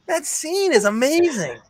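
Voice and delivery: high, slow, drawn-out gushing; thrilled, stretched-out praise; real enthusiasm. Real, not acted. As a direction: rave about it with pitch sky-high. Gushing over favourite scene.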